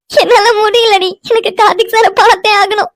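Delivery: high-pitched tearful wail; crying. Acted. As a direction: sob the words out in distress. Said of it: "அழுகாத, கண்டிப்பா பார்க்கலாம்". (அழுதுகொண்டே புலம்பும் குரல்)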